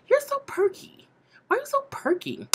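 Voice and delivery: mimicking the cheerful girl in a high voice; mock perky girl.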